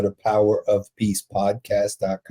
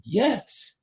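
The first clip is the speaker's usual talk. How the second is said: leapt-out answer; 11 semitones higher, 4 dB quieter than usual. Retold or imitated. Preacher answering the inmate's question, a sudden high yes. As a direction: let it leap out, pitched way up. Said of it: "it leapt out of me".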